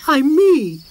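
high, loud unhappy cry. Acted. Moomin Troll cries to his mother, high and unhappy. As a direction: cry it out in distress. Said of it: "cried the unhappy Moomin Troll".

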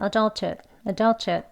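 adulthood clipped quick with the ending swallowed; fast. Shown as a demonstration. Teacher showing learners the quick casual form. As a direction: rattle adulthood off briskly. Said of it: "빨리 발음하시면".

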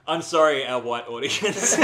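hammed-up public apology; mock apology. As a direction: announce a fake apology to listeners. Joking apology to the audience.

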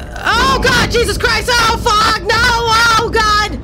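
high-pitched real fright; startled scream. Real, not acted. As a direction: cry out in sudden panic. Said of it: "That gave me a heart attack".

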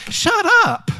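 very high, squeaky exasperated cry; high-pitched, playful dismissal; exasperated outburst. Acted. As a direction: let the voice leap way up in mock exasperation. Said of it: "I sometimes want to say".

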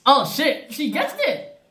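excited. Real, not acted. Friend guesses the ingredient.

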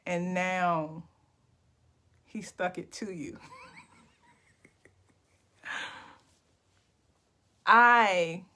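slow, drawn-out poem recital with long pauses; spoken-word poetry. Pretend. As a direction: make up a poem line by line, savouring each phrase. Improvised poem continues.

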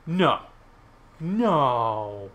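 loud, exasperated cry of protest; frustrated shout. Real, not acted. Player protesting a setback in game.

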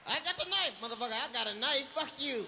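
loud, high threat at the hijacker; mock defiant shout. Passenger yelling at the hijacker, loud and high. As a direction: yell it in his face.

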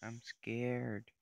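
loud scared. Real, not acted. Tense moment in game.

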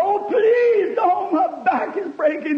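high, shouted cry of pain; agonized plea. Retold or imitated. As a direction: scream it like a boy pinned under a car. Boy pinned under car pleading.

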